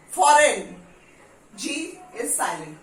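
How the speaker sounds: usual speaking voice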